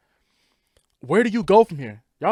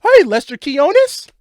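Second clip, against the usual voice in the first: mock greeting; 9 semitones higher, 10 dB louder than usual. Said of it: "Would she even be like".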